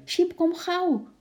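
high, drawn-out excited call; happy call. A narrator's reading. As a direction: call your pet over eagerly. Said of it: "riep ze blij". (blij en dringend roepend)

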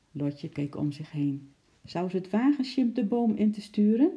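plain reading voice

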